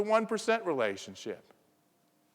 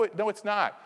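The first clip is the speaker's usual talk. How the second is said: usual speaking voice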